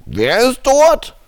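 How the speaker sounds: over a landline phone. Acted.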